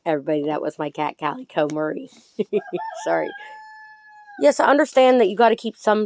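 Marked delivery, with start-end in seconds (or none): 2.4-3.0 short high embarrassed laugh; quick giggle. Real, not acted. Laughs after cat interrupts.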